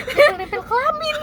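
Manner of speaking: giggling out the punchline; laughing. Retold or imitated. Quotes him while laughing.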